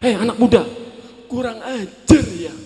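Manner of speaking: loud angry scolding; old man scolds the speeding youth, loudly angry. Retold or imitated. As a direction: scold like a furious old grandpa. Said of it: "Wajahnya merah padam, marah".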